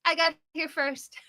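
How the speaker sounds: lightly voiced stubborn child, high; hint of a small girl standing her ground; defiant little girl. Daughter keeping her roller coaster car, lightly mimicked defiance. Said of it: "and she's like".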